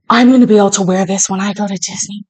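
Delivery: normal talking voice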